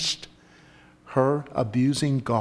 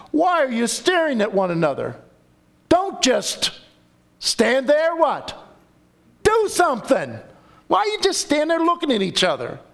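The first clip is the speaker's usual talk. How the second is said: high, fast, urgent scolding of idle sons; a father pushing his sons into action, fast and high; exasperated father; 10 semitones higher than usual. Acted.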